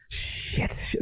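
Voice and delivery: put-on hushed kid swearing under breath; muttered curse. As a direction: whisper the curse like a little girl. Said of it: "the kid just out of nowhere just goes".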